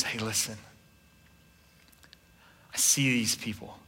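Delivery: soft, low, tender promise; quiet voice of God. Retold or imitated. God promising blessing to the midwives, soft and low. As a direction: speak it softly as God reassuring. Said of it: "But God sees it and he goes".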